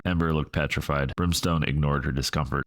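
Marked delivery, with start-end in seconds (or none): none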